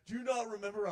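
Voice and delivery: high-pitched, hurt protest; upset. Acted. Offended spirit asks his old companion, upset high plea. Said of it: "he's all upset".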